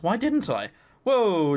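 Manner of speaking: ordinary talk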